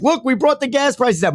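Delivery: high, smug mock boast; mocking politicians. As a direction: parrot them like a gloating politician. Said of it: "And then they were like".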